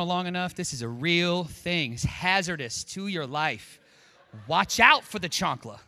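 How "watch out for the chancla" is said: mock warning. Warning about the chancla.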